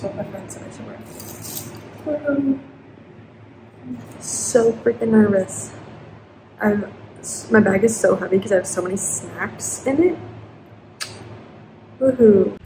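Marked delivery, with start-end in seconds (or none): 2.0-2.6 high, loud happy woohoo; excited woohoo. Real, not acted. Excited cheer. 4.2-10.2 jittery excited rush; nervous excitement. Real, not acted. Nervous before event. 12.0-12.6 excited woohoo. Real, not acted.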